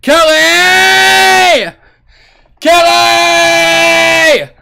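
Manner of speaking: loud, high call across the room; shouting a name. Real, not acted. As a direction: yell the name out. Calling out loudly to Kelly.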